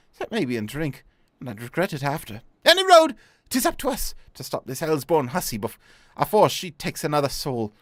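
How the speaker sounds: put-on lively, superstitious old sea dog in muddled Cockney; hammed-up Cockney-Scottish sailor's yarn; vague Cockney-Scottish accent. Old sailor warning a recruit about the siren, in vague Cockney-Scottish. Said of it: "do a vague Cockney Scottish accent".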